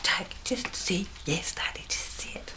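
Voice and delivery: whispered urging; whispering. Retold or imitated. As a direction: whisper it to the child. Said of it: "And I was like".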